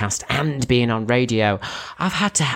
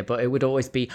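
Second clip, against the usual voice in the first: ordinary delivery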